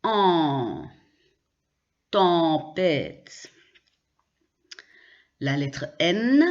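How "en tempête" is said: en long, then Tempête with em as nasal an. Shown as a demonstration. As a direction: draw out en and the first vowel of Tempête, no M. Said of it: "on prononce de la même façon". (« en, Tempête » avec une voyelle nasale allongée)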